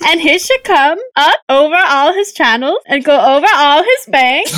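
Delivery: lilting, botched Swedish-like reading; Swedish-sounding urchin accent. Acted. Co-host attempts an urchin reading, lilting Swedish-sounding accent. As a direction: swing the scripture up and down in fake Swedish. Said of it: "you're sounding more like Swedish".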